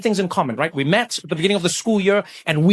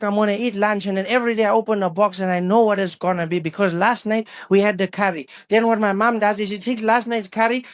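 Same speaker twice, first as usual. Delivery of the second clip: higher-pitched complaining rant; exasperated schoolboy; 4 semitones higher than usual. Retold or imitated. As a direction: play a schoolboy fed up with his lunch. Acting the friend's exasperated rant.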